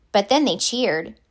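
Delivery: usual speaking voice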